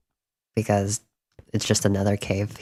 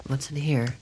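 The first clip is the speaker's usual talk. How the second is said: ordinary talk, nothing marked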